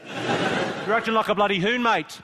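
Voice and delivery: loud, drawn-out Australian slanging; Australian accent. Acted. Comic teasing an Australian audience member, loud Australian accent. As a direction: scold in a broad, stretched Australian accent. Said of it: "I can do Australian".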